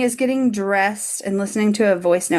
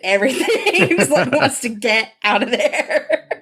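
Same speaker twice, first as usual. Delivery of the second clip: higher, talking through laughter; laughing; 6 semitones higher than usual. Real, not acted. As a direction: crack up while describing it. Cracking up while describing the character.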